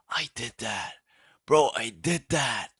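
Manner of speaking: imitating a dazed, awestruck friend; wowed by himself, hammed up a little; mock amazement. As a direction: draw out the wonder, as if stoned and amazed. Stoned friend marvels at himself.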